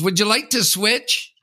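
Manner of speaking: sweetly entitled ask; mock mother. Imitating the mother asking again, high and slow. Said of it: "And she goes".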